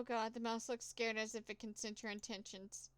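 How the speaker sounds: usual speaking voice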